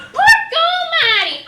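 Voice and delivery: high, loud cry of amazement; scandalized pig outburst; shocked exclamation. Acted. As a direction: exclaim it like a mild oath. Pig exclaims while recounting shock.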